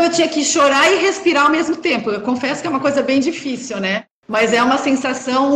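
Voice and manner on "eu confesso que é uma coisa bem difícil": ordinary delivery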